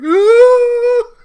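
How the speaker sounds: loud, very high scream; startled scream. Acted. Mac screams as the lasso yanks him, shrieking. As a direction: shriek as you're yanked away.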